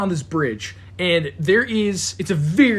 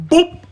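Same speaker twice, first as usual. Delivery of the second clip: playful boop; 11 semitones higher, 8 dB louder than usual. Retold or imitated. Sound of arm popped off.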